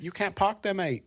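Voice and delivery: high mock British accent; playful British passer-by impression. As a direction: put on a British accent, pitched well up. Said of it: "I have sort of an accent".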